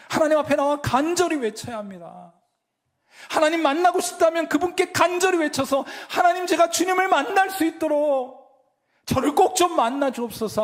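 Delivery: high, loud impassioned call; fervent preaching. Acted. Pastor urging the congregation to cry out, loud and high. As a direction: preach it with full fire.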